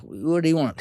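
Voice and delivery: high, loud retold challenge; mock demanding challenge. Cellmates confronting Brad on his return, high loud challenge. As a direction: bark the question at the new guy.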